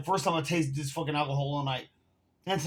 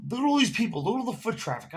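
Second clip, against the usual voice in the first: excited bragging; 8 semitones higher than usual. Real, not acted. Boasting about his spot.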